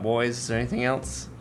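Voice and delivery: ordinary delivery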